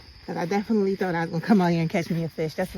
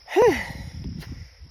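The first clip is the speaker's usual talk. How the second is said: tired sigh; 11 semitones higher than usual. Real, not acted. Exhausted at top of hill.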